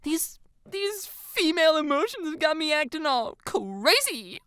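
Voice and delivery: put-on high, upset girl voice; tearful whine. A narrator's reading. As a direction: whine it out, near tears, very high. Tearful Jalen, wiping an eye.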